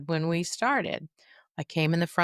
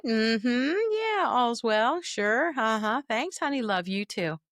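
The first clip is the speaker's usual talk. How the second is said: lightly voiced mother, slow, all is fine; breezy mom; 4 semitones higher, slower than usual. Mother assuring out-of-town child everything's fine, breezy. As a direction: brush it off cheerfully. Mother on phone reassuring family.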